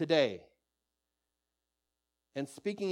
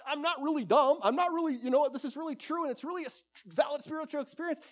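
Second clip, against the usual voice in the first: high-pitched defensive believer; imitating someone trying to prove themselves; mock defensive pleading; 7 semitones higher than usual. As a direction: plead your case in a high voice. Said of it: "your question's just trying".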